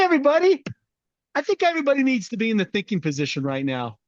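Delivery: a sugary-sweet drill sergeant, lightly voiced; fake-nice commander, stretching out everybody high; a hint of a sweetly menacing boot camp commander; mock nice drill instructor. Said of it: "started talking really nice".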